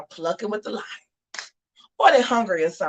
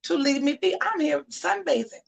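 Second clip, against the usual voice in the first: high, softer lion's complaint; high-pitched, restrained warning; pestered lion; 8 semitones higher, 4 dB quieter than usual. Acted. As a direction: voice a bothered lion, pitched up and held back. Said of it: "the lion looks over and says".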